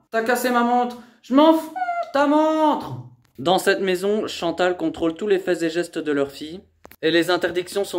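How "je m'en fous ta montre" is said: real furious yell; angry shout. Real, not acted. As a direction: yell she does not care. Mother says watch was broken.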